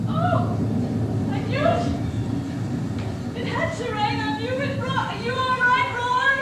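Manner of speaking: loud, high panicked calls into the rain; frantic calling. Acted. Wife calling out to her fallen husband, frantic and loud. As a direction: yell down to him in alarm.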